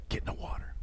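hushed retelling of a stern order; whispered order. Retold or imitated. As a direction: breathe the command out softly. Instructor's order retold on pier.

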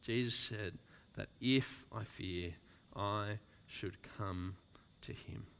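almost fading into a hush; soft recitation. Acted. Preacher softly echoing the sung refrain.

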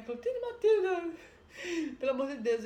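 loud, high frantic plea; panicked call. Retold or imitated. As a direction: call them in terror, half laughing. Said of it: "Começa a gritar pras irmãs". (grito desesperado, meio rindo)

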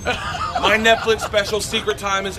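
loud, high hype-man promo; hyped promo. Retold or imitated. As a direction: pump up the Netflix special. Hyped promo video replayed.

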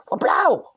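a silly high-pitched yelp; high silly catchphrase yelp; goofy yelp. Acted. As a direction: toss out a cartoonish squeal of a catchphrase. Silly exclamation after merch plug.

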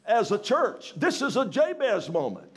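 loud, high preaching voice; preaching at full force. Acted. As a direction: preach it out to the church.

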